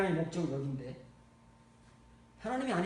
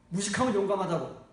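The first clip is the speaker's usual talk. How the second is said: ordinary talk, nothing marked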